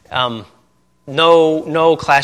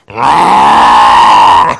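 loud long engine roar imitation; motorcycle roar; 13 dB louder than usual. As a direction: rev it like a motorcycle passing.

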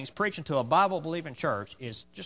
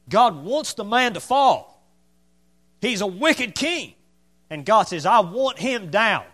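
slow, loud pulpit declaration, pitched up; forceful preaching; 6 semitones higher, 8 dB louder, slower than usual. Real, not acted. Preacher declaring God's judgement on a wicked king, forceful preaching. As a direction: hammer each phrase out with drawn-out force.

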